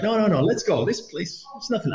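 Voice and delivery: high, insistent imitation of a pal; acted pushy dismissal. Retelling the man pushing to leave the bar, acted pushy dismissal.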